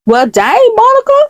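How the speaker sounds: high-pitched mock-offended reaction; squeaky, affronted backing off; taken aback. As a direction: react to a telling-off, voice jumping way up. Employee reacts to being scolded.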